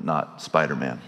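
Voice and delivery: usual speaking voice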